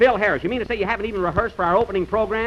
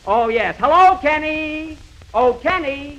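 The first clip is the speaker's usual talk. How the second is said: calling out; 10 semitones higher, 3 dB louder, slower than usual. Acted. Calling out to Kenny on porch.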